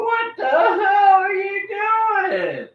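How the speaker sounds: high, slow, shouted at the dog; mock shout. Man mock-shouting at the dog digging in his pockets. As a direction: fake outrage at the snooping dog. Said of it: "I go".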